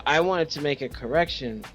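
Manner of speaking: ordinary talk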